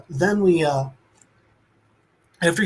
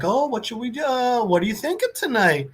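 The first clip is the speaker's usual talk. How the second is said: higher, lilting pet-owner voice; talking to a dog; 7 semitones higher than usual. Retold or imitated. Man asks his dog about tonight's book, lilting pet voice. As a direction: imitate chatting sweetly to your dog. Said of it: "he's going in with his dog and he's like".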